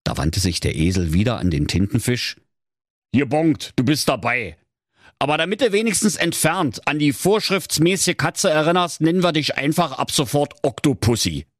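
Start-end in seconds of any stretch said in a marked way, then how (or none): 3.1-11.5 higher-pitched donkey character; bossy donkey. Acted. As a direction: hand out orders as the donkey bandleader. Donkey recruits the octopus. (bestimmender Esel, etwas höher)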